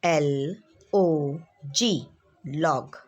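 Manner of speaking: ordinary delivery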